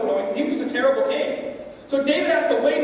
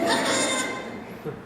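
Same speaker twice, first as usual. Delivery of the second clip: high giggle; 12 semitones higher, 5 dB quieter than usual. Real, not acted. Laughing at own mock taunt.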